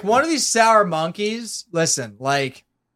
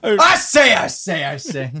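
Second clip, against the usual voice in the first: Foghorn Leghorn; slower than usual. Acted. Imitating the cartoon for co-hosts, Foghorn Leghorn bluster. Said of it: "more like Foghorn Leghorn".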